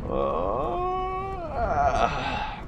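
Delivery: high soft wail breaking into a groan; drawn-out high moan, then drop; exasperated wail; long groan. Real, not acted. Wordless groan after mishap.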